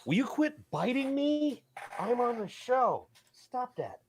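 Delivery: high sing-song scold at the animal; scolding pet. Real, not acted. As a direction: talk up high to the biting pet. Scolding pet during stream.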